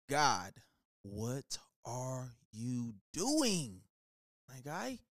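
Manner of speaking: very slow, stretched "God, what are you doing", savoured; milk every word like a favourite catchphrase; drawn-out plea. Shown as a demonstration. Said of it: "just feels so good when I say it".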